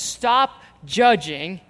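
slow, deliberate order to stop judging; slow firm command. Acted. Paul commanding the church, slow and firm. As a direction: lay each word down firmly. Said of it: "And what he says is".

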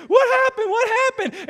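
high, loud mock panic through laughter; laughing alarmed wife. Wife rushing into the bathroom, laughing alarm. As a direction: cry out in alarm while laughing. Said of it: "Anna says".

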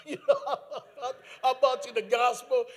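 loud words through laughter; laughing. Real, not acted. Preacher retelling his talk with supervisor, laughing. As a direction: push it out loudly while cracking up.

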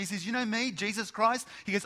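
loud, high, driving sermon voice; preaching at full force. Acted. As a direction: proclaim it, building the fire.

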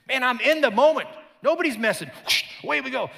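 high, loud hyped-up bravado; all fired up, no hesitation; pumped Peter. Retold or imitated. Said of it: "It's Peter".

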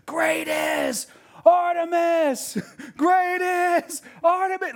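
high, drawn-out stadium chant; crowd yelling. Acted. Theater crowd yelling like at a basketball game, chanting. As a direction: bellow it slowly like a basketball crowd. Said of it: "twenty-four thousand people all yelling".

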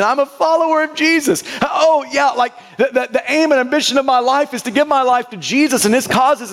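mock startled morning realization; groggy sudden realization. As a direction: act out remembering all at once. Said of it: "It takes me a while to remember".

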